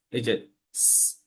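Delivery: a slow, soft hissing স; drawn hiss. Shown as a demonstration. Teacher demonstrates the স sound to students. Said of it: "এই সাউন্ডটা হবে".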